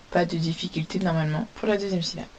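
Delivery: usual speaking voice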